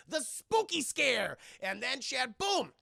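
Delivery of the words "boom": ordinary delivery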